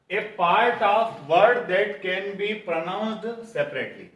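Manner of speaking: very slow, each word spaced out for students to copy; slow dictation. Shown as a demonstration. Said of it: "dictates the question slowly". (बहुत धीमे, शब्द-शब्द अलग करके dictation)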